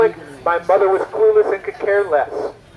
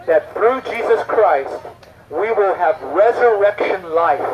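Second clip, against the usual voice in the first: ordinary talk, nothing marked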